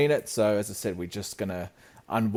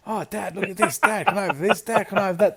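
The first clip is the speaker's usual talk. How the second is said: lightly voiced nagging kids, higher pitch; pestering kids; 9 semitones higher than usual. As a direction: mimic children pestering their dad. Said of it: "They've just got this ability".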